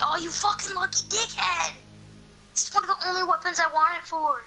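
usual speaking voice

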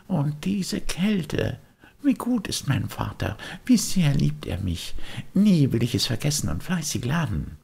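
put-on high, eager puppet boy dreaming aloud; a wooden boy spinning daydreams; bright high boyish chatter; Bengele's voice.

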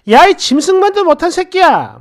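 high, loud scolding; outraged insult. A narrator's reading. Woman in an old joke berates man, outraged insult. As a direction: hurl the insult in mock outrage.

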